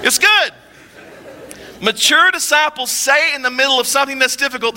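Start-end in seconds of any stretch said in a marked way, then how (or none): 0.1-0.5 high, loud punchline cheer; acted gleeful reassurance; super optimistic. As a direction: sing out the optimist's catchphrase over laughter. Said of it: "just super optimistic".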